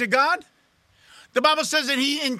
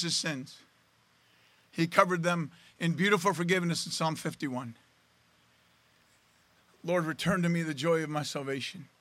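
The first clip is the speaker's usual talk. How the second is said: low, soft, grave delivery; hushed solemn preaching; 7 semitones lower, 7 dB quieter than usual. Real, not acted. Preacher telling church of David's sin and repentance, hushed and solemn. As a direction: let it sink low and quiet, with long pauses.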